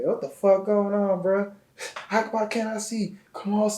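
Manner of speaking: high, drawn-out comic voice; mock-confused squeal of questions; goofy bewilderment. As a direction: exclaim in a silly falsetto, baffled. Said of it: "you know I'm goofy".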